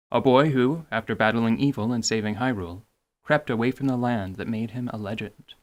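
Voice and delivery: storybook narration. A narrator's reading. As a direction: read it like an old legend. Reads game intro narration.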